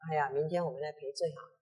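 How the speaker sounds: quick, soft persuasion; soft coaxing. Acted. Friend urging the retainer to apologize, soft coaxing. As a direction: gently talk him into apologizing.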